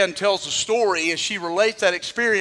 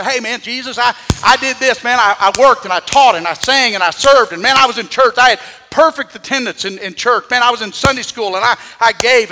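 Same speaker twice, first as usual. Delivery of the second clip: pleading boast; 3 semitones higher, 8 dB louder than usual. Retold or imitated. People boasting to God at judgment.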